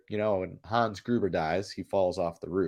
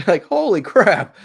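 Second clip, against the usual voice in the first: amazed; 10 semitones higher, 9 dB louder, slower than usual. Real, not acted. Disbelief at British actor.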